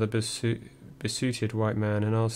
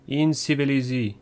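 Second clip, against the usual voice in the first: a cold, higher, clipped French word from the copper; copper's menacing one-word reply to Tom; chilling retort; 4 semitones higher, 3 dB louder than usual. Retold or imitated. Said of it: "one of the most chilling monosyllables".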